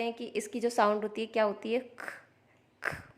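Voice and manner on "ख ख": ख ख said as a light breathy puff; light unvoiced ख sound. Shown as a demonstration. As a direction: barely voice the ख, just air. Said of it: "बहुत ही light".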